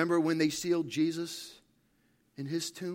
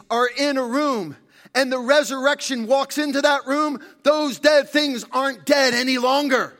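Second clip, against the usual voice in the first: high, loud proclamation, landing hard on key words; full-force preaching; 8 semitones higher, 11 dB louder than usual. Acted. Preacher proclaiming the resurrection to the church, full-force preaching. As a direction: proclaim it, building louder to the end.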